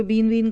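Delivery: बैन बैन misread as "बीन वीन"; "बीन वीन", a learner's wrong vowel. Shown as a demonstration. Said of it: "इसको पढ़ने में कई गलतियाँ करेंगे".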